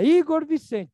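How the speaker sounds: a mother summoning her son for trouble; stern mother calling. Retold or imitated. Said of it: "se ela falar". (mãe brava chamando Igor Vicente)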